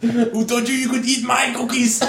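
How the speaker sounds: high mock action hero; Schwarzenegger voice. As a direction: growl it like Arnie. Quoting Arnie line.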